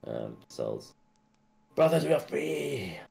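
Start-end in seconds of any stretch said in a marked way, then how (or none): 1.8-3.0 small high-pitched cry of a freed creature; freed salamander. Acted. Freed salamander calls into the cells to its brothers, jubilant. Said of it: "you hear him sort of say".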